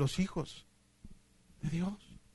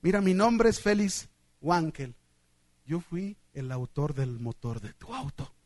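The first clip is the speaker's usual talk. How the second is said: low, understated reveal, trailing softer; a hint of a kindly old man; lightly voiced old man; 6 semitones lower, 3 dB quieter than usual. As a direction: introduce yourself calmly as the wise elder. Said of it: "el viejito se arrimó y le dio la mano".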